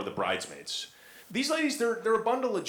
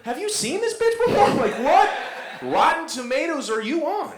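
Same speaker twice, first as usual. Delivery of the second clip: mock outrage; 13 semitones higher, 8 dB louder than usual. Outraged reply to spoiler.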